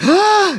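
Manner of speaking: sharp high gasp of alarm; mock gasp. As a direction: gasp like you just spotted danger. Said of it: "You're like".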